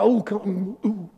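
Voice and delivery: mock anxious ooh sounds, fading; fretful whimpering. Preacher mocking fearful Bible readers, mock whimper. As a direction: whimper like a nervous believer. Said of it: "We read the Bible like that".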